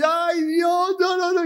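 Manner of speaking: high, wailing cry of despair; whining complainer. Retold or imitated. The preacher mimics believers crying out to God in a high wail. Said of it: "Nos quejamos y".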